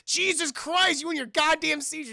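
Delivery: high, loud exasperated outburst. Acted. Davey fed up with Whitey's seizure, exasperated. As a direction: raise the voice, fed up.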